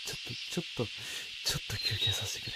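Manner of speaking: hushed, shaken plea; barely voiced, drained; breathy whisper. Real, not acted. Breathless after scare.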